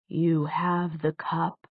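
slow, soft, stunned question; stunned hush. A narrator's reading. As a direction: ask it slowly, barely daring to believe. Stunned tutor hears of the cup.

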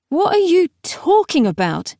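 high-pitched, insistent demand. A narrator's reading. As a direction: demand an explanation, pitch jumping up. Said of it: "she demanded".

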